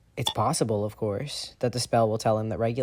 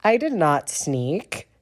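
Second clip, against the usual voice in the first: slow, indignant protest; protest, offended; affronted; 6 semitones higher, 5 dB louder, much slower than usual. A narrator's reading. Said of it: "Remus looks affronted".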